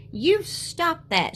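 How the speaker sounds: high, slow angry scold. A narrator's reading. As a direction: stamp it out crossly. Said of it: "she said angrily".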